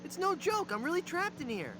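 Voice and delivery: high, desperate insisting. Acted. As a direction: plead to be believed. Insisting he is trapped.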